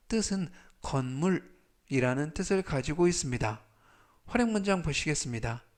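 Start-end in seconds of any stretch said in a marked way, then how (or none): none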